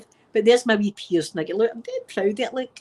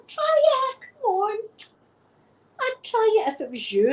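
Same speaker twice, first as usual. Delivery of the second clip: urging; 13 semitones higher than usual. Retold or imitated. Urging ex-husband to try gas.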